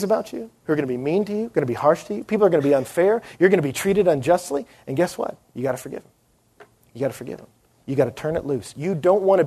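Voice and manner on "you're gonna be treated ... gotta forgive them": normal talking voice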